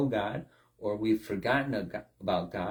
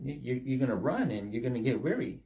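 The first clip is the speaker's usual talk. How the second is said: ordinary talk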